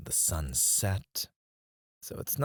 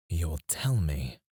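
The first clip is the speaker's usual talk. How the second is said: "You'll tell me" slowed right down; measured, deliberate "You'll tell me"; slow demand; 3 semitones lower, slower than usual. Acted. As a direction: spell out "You'll tell me" slowly, as an order. Boss repeats the demand slowly.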